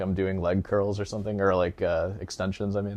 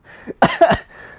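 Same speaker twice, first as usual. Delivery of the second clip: squealing laugh; 10 dB louder than usual. Real, not acted. Laughing at the roast.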